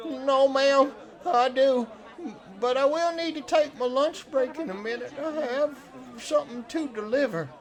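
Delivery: meek fish-camp hand. Acted. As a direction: answer the boss meekly, pitched high. Game master plays Cletus.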